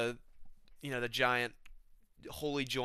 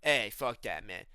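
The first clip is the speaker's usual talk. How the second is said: Nate Diaz; 4 semitones lower than usual. Acted. Bouncing off a buddy at camp, low dismissive fighter impression. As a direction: imitate a fighter dismissing it under his breath. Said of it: "Nate Diaz will be like".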